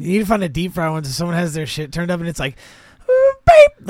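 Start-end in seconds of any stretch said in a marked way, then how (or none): 3.1-3.9 sharp high-pitched beep; imitated smoke alarm beep. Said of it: "it's like a sharp, like, it's like a re-, a reverb".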